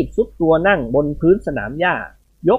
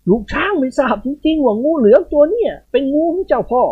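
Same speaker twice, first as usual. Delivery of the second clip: pleading trembling; 9 semitones higher than usual. A narrator's reading. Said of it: "ด้วยเสียงสั่นเครือ".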